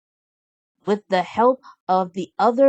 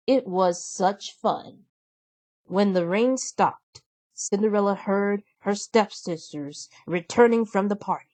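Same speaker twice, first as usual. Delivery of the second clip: plain reading voice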